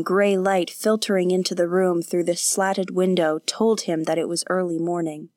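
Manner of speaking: plain reading voice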